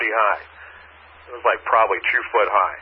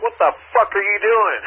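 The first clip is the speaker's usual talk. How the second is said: high-pitched mock yell of disbelief; indignant outburst, retold at moderate volume; retold angry yell; 8 semitones higher than usual. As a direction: re-enact yelling at a driver, pitch jumping up. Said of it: "I'm yelling and screaming at this chick".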